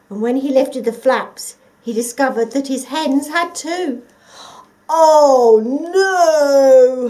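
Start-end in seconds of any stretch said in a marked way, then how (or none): 4.9-7.1 loud anguished howl. Acted. The dog howling over his lost hens, loud. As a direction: wail in despair. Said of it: "howled the dog".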